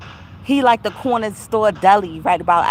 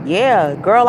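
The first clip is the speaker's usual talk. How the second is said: usual speaking voice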